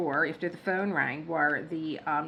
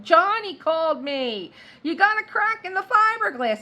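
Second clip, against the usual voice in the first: much higher and louder, a mock shout down the street; calling out; 12 semitones higher, 11 dB louder than usual. Friend Sean shouting from the street, mock yell. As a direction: yell it across the road like a neighbor.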